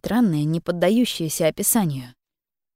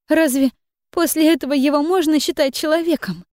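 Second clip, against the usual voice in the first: agitated tearful rant, higher, louder; shaken tearful witness; 8 semitones higher, 3 dB louder than usual. Acted. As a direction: pour out the hurt through tears. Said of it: "ещё более взволнованная".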